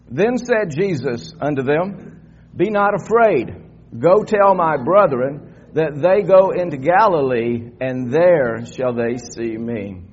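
slow, emphatic Bible reading; congregational scripture reading. Real, not acted. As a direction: read it out slowly for all to follow.

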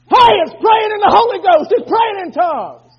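high, loud preacher's shout; preaching shout. Acted. Preacher rebuking wimpy prayer, loud high shout. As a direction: hammer it out at the top of your voice.

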